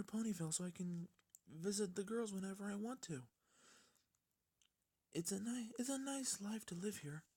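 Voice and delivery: gentle shy pony explaining herself; Fluttershy voice. Acted.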